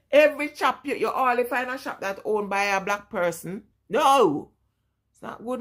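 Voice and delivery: ordinary talk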